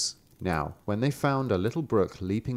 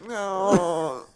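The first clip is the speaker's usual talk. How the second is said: mock crying deer; 9 semitones higher than usual. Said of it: "the deer just goes".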